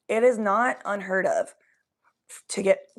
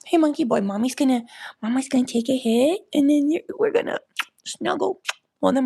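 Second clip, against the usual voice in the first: higher-pitched cooing to a pet; pet talk; 6 semitones higher than usual. Real, not acted. Talking to pet, "monkey boy.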